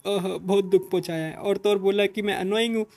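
milk the fake hurt shamelessly; over-acting. A narrator's reading. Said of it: "उसकी over acting देख रहे". (नाटकीय, overacting वाला रोना-धोना)

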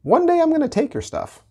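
squeaky mock-divine warning; mock voice of God. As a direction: play God teasing the crowd. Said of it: "And God says".

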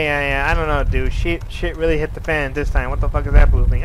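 usual speaking voice

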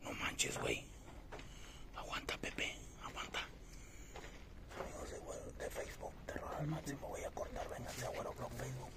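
soft hushed plug; whispering. Real, not acted. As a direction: whisper the shoutout quickly. Whispered promo during ghost hunt.

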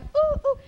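mock cooing. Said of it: "Entonces ya voy a hablar así".

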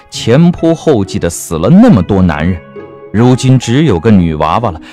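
ordinary delivery, nothing marked

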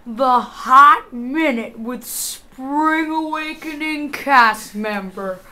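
slow, stretched announcer delivery; show host sign-off. Acted. Host closing the interview with a cast member, announcer style. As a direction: wrap up the show with drawn-out announcing.

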